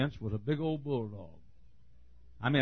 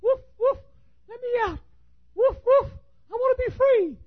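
very high little-dog voice begging; squeaky high dog voice pleading; talking Chihuahua; 6 dB louder than usual. Acted. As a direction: whine to get out like a caged lapdog. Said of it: "He was saying".

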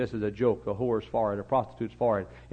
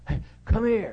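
put-on child calling; 10 semitones higher, 6 dB louder than usual.

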